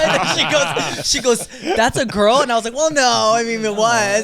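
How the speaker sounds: giggling through the story; words bubbling with laughter; laughing while telling. Real, not acted.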